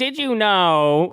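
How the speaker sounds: mock quote. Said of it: "He's like".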